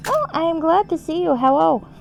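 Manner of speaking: high-pitched, eager hello; delighted greeting. Real, not acted. Streamer glad to see a viewer arrive, delighted.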